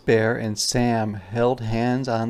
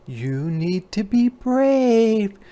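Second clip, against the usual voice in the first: mama bear, much higher, slow and gentle; encouraging mama bear; 10 semitones higher, 3 dB louder, slower than usual. Acted. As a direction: urge the cub to be brave.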